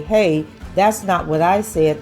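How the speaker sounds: ordinary talk, nothing marked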